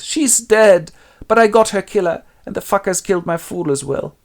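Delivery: high, loud lament; grieving Lear. Acted. Lear staggers in with his dead daughter, high lament. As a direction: cry it out over the body.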